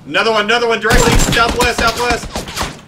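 urgent callout. Real, not acted. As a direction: shout the position fast.

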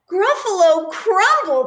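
high, loud aghast cry; mock horror. Gruffalo hears he's the meal, crying out in mock horror. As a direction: gasp in hammed-up horror.

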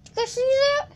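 high, surprised exclaim; startled. Real, not acted. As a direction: react in shock. Startled in game.

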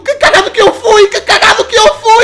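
loud, high, slow yells of triumph; ecstatic shouting. Real, not acted. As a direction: scream with joy at the lucky kill. Lucky kill, shouting. (gritos de euforia)

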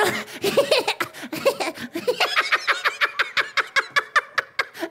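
drawn-out laugh breaking into rapid staccato bursts; exaggerated machine-gun giggle; long clownish laugh. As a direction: cackle on and on in pulses. Clown laughs at his own joke.

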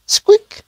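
tiny, very high mouse squeak; a sudden tiny squeak in the dark. Acted.

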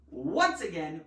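ordinary talk, nothing marked

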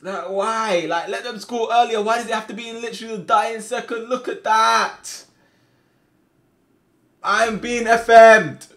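high, loud protest at the injustice; outraged rant. Real, not acted. Player rages at a dying-second goal, high outraged shout. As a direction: shout it in real exasperation. Ranting at late goal conceded.

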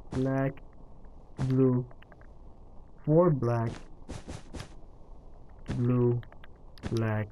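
usual speaking voice

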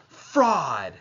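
high, loud, furious shout; girl shouting fraud at the captain; screamed insult. Acted. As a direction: spit the word at him. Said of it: "I screamed at him".

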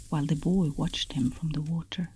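ordinary delivery

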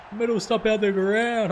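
high, loud thrilled yell; excited shout. Real, not acted. Gamer cheering his bowler's wicket, excited high shout.

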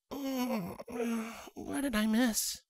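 high, soft and sleepy mare; just woken up, drowsy and high; groggy female voice. Acted. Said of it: "she groggily asked".

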